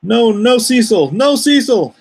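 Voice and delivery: high, loud, slow "no" like scolding a pet; hammed-up scolding of Cecil in the chat; mock scolding. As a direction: wag a finger at the troublemaker.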